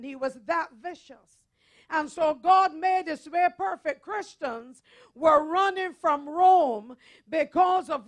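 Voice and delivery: forceful, declarative sermon delivery; preaching. Acted. Preacher telling the congregation of Paul before Nero, forceful preaching. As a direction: preach it with hard-hit key words and firm pauses.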